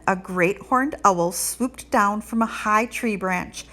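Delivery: ordinary delivery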